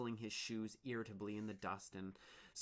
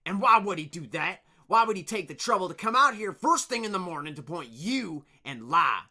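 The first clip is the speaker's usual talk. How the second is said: angry; 8 semitones higher, 16 dB louder than usual. Acted. Leader interrogates.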